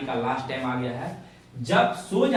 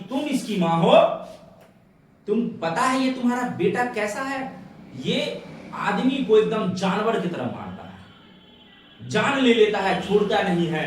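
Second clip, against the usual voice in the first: high, loud imitation of an irate soldier; angry accusation; 7 semitones higher, 6 dB louder than usual. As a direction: hurl the charge at the mother, acted. सिपाही माँ पर आरोप लगाता. (गुस्सैल सिपाही का ऊँचा अभिनय)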